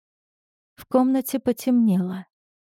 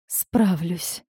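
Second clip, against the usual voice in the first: soft breathed-out word; exhaled; 3 dB quieter than usual. A narrator's reading. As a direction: breathe it out, softer. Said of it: "выдохнула Золи".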